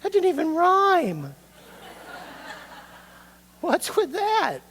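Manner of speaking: high, loud mock-baffled listener; squeaky mock outrage at a poem that won't rhyme. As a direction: play the confused kid who expected a rhyme. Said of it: "It's like".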